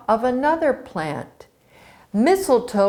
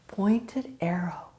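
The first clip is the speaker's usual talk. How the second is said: whisper; 8 dB quieter than usual. A narrator's reading. Storyteller hushes for effect.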